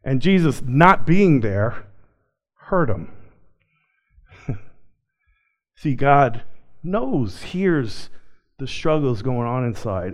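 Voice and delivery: ordinary talk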